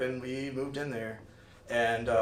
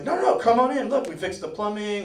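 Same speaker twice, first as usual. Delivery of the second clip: eager welcome; 7 semitones higher, 6 dB louder than usual. Retold or imitated. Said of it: "he's like".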